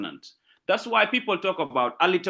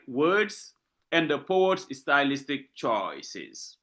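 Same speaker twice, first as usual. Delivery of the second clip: usual speaking voice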